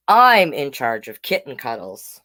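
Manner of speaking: ordinary delivery, nothing marked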